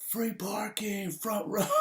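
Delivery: very high, drawn-out mock yell; gleeful yell. Man springing punchline on Instagram followers, gleeful yell. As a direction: crow it in a squeal, held back in volume. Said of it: "and I just yell out".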